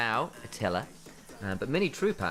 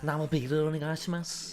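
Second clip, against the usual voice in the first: higher-pitched Scouse take on Japanese; Scouse-accented Japanese; 7 semitones higher than usual. Shown as a demonstration. Co-caster echoing the Scouse Japanese joke, Scouse accent. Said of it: "like Scouse in Japanese".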